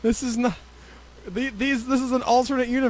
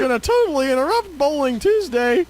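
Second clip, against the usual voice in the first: folksy Southern griping; Southern accent; 5 semitones higher than usual. Acted. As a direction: complain in a put-on Southern twang. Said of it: "we're back to Southern".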